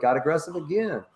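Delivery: ordinary talk, nothing marked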